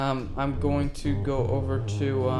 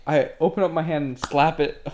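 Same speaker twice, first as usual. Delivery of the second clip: normal talking voice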